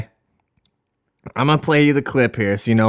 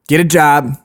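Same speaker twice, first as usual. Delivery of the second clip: ordinary talk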